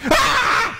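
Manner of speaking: sharp, high mock shriek; imitated angry shriek. Friend mirroring the shriek back at her. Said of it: "She imitated the exact tone of voice".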